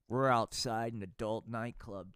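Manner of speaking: ordinary talk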